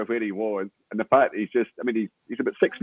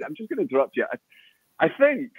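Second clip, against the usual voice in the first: ordinary delivery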